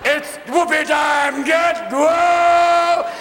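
hammed-up scream of a woman in labor; mock labor scream.

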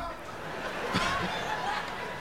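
squeaky breathless laugh; a laugh that slips out high and airy; high wheezing laugh. Real, not acted. Comedian cracking up at his own story, high wheezing laugh.

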